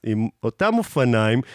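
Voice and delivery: ordinary talk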